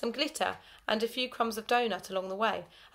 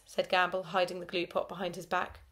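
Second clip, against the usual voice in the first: ordinary delivery, nothing marked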